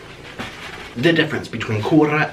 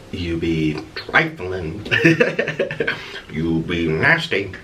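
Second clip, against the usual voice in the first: mock sassy scolding; 10 semitones lower, 3 dB quieter, much slower than usual. Teasing the parrot playfully.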